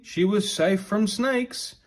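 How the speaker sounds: plain reading voice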